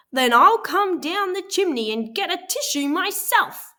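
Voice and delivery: high, loud mad roar; roaring wolf. A narrator's reading. Angry wolf threatens the pigs from the roof, roaring. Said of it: "he roared".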